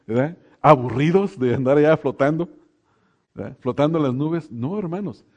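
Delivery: ordinary talk, nothing marked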